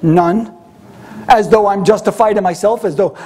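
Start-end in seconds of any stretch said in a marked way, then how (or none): 0.0-0.5 attitude. Retold or imitated. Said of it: "You know the attitude?".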